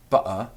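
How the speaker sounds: "butter" with a glottal stop for the t. Shown as a demonstration. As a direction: catch the breath mid "butter". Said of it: "say butter".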